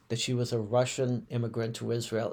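normal talking voice

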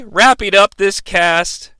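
ordinary talk

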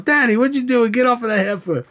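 high-pitched retold outcry; mock shocked scolding. Son catching his daddy with the neighbor, mock shocked scolding.

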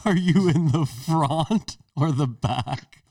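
high, slow, "front" stretched as frawnt; mocked vowel. Teasing the friend's pronunciation, slow high "front" as frawnt. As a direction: lean hard on "front", said frawnt. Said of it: "I don't think that's how I'm saying it".

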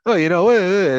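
hammed-up Sopranos-style New York accent, high and loud; Boston friends doing a put-on New York accent; mock New York accent. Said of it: "they start talking like that".

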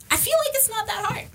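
squeaky-high, tongue-in-cheek aside; high-pitched understatement. Real, not acted. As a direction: say it way up high, as if stating the obvious. Repeats her remark in high voice.

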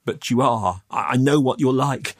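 shakily contradict, with a slight stammer; trembling. Acted. Youth distrusts the man's promise, trembling. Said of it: "I was still trembling".

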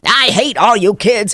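exaggerated kid-hating outburst; mean uptight principal. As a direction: play a mock-furious school battle-axe. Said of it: "she was kind of uptight".

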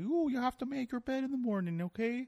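high, put-on lecturing voice, mocking; mocking Jordan Peterson. Host mocking a self-help professor to his guest, high put-on lecturing voice. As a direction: lampoon a preachy professor, pitched high. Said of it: "like, some Canadian guy".

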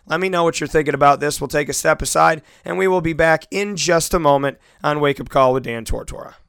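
normal talking voice

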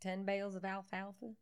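put-on Florida country accent; lightly hammed-up Southern accent; mock rural accent. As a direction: flirt in a hokey country accent. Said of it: "He doesn't even have an accent".

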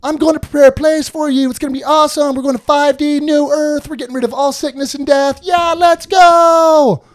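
party-bro Jesus hyping the disciples; hyped-up bro Jesus. Retold or imitated. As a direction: play Jesus as a pumped surfer bro.

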